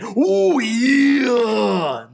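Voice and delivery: loud, high gravelly wrestler growl "ooh yeah"; Macho Man Randy Savage. Retold or imitated. Host cameos as the wrestler in a visual novel, shouting. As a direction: belt it like a wrestling promo. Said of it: "my voice ... little bit of a Randy Savage impression".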